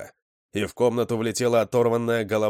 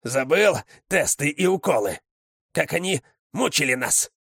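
higher, heated angry questioning; bitter accusation; 7 semitones higher than usual. A narrator's reading. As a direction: throw the accusation at your brother. Озлобленная сестра спорит с братом. (гневно, с упрёком)

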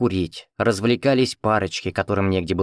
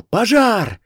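high, drawn-out shout; yelled alarm; 11 semitones higher, 4 dB louder than usual. A narrator's reading. Rescuer scattering the onlookers, high yelled alarm. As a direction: yell it to scatter a crowd. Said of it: "заорал".

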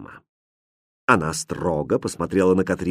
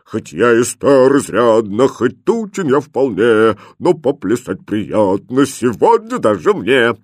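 big, slow chanted bass verse; thick bass; 4 dB louder than usual. A narrator's reading. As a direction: chant it like a stout old cook. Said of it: "запел густым басом". (густой бас, нараспев)

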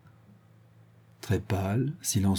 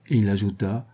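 plain reading voice

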